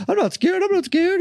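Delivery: put-on high squeaky kid insisting he's not scared; squeaky little brag of a frightened child; mock kid voice. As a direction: play a scared kid faking bravado, way up high. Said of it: "so you're like".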